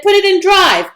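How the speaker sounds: high, loud and urgent; panicked instruction. A narrator's reading. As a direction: bark the order in a panic. Panicked escape from attackers.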